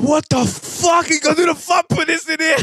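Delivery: high mock outraged shout, pitch way up; shocked workman. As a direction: shout it like a stunned workman seeing botched work. Retold shocked workman outburst.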